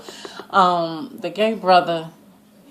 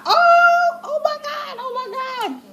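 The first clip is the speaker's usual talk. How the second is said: shrieking oh my God. Real, not acted. Viewer reliving the twist, shrieking. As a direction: squeal then let it drop away. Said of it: "I was like".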